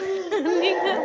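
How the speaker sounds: a bright high giggle; real laugh. Real, not acted. Laughs while filming.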